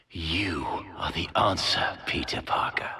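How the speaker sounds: breathless whispered realization; wondering whisper. Acted. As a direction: whisper it as the idea dawns. Muttering discovery to himself.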